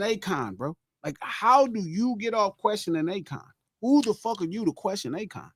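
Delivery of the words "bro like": ordinary talk, nothing marked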